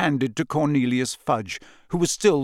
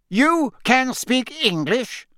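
high, slow, incredulous outrage; outraged; 10 semitones higher, slower than usual. A narrator's reading. Fudge to the Bulgarian Minister, outraged. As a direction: demand it word by word, affronted. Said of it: "sounding outraged".

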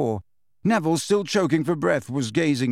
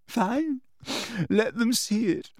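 high, tearful rant; sobbing; 7 semitones higher than usual. A narrator's reading. Hermione lashing out at Umbridge, sobbing. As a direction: spite through tears, stumbling on words. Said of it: "now sobbing into her hands again".

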